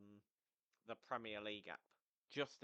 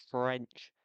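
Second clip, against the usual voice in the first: one word blasted out loud; abrupt loud burst; sudden loud outburst; 15 dB louder than usual. Real, not acted. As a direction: punch the word out very loudly. Blurts one word loudly mid-apology.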